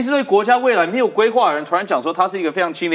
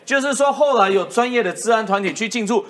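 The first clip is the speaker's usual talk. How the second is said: usual speaking voice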